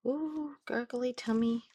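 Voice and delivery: high cooing voice. Real, not acted. Parent cooing to baby over gurgly tummy, high baby talk.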